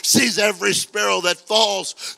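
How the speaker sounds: shouted, high, drawn-out preaching cry; full-force preaching. Real, not acted. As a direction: thunder it to the whole congregation, slowly.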